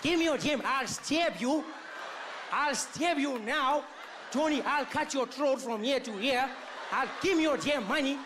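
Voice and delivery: robber in a mock Indian accent threatening Tony; Indian mugger. Comedian voicing an Indian robber, mock Indian accent. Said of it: "You'd be like".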